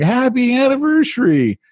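high, sing-song happy anniversary; cheery greeting. Retold or imitated. Wife hugging husband, cheery high greeting. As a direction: greet him warmly and high.

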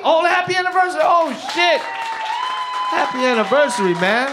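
loud, high-pitched burst of surprise; real excited congratulations. Real, not acted. Comedian to couple married thirty years today, excited shout. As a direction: cheer them on, rising into a squeal.

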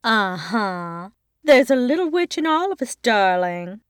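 knowing, quick and teasing; aunt teasing her sister knowingly; smirking. A narrator's reading. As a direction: smirk through the words.

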